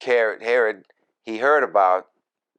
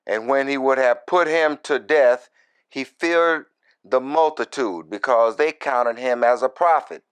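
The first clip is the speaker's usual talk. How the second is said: scripture reading; 5 semitones higher than usual. Acted. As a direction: lift the verse up as you read it. Reads scripture aloud.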